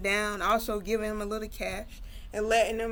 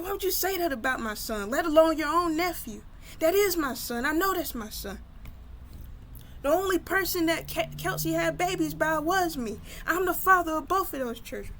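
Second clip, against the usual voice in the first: heated, higher-pitched rant; going off; 7 semitones higher than usual. Retold or imitated. Brother defends his son to his sister, going off angrily. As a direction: tear into her, pitched up and insistent. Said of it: "he went off on his sister".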